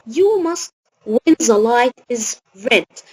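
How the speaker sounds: very slow, with gaps between the words; slow dictation. Shown as a demonstration. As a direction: read the example slowly to young learners. Teacher dictating example sentence.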